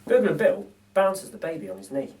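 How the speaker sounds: plain reading voice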